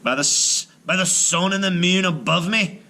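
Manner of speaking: high startled giant oath; giant exclaiming. Acted. Giant startled by the talking baby, exclaiming. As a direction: blurt out the oath in shock. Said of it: "the giant exclaimed".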